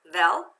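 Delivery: "Vel" as one isolated, loud syllable; syllable read-out. Shown as a demonstration. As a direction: sound out "Vel" firmly on its own. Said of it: "Read it with me now".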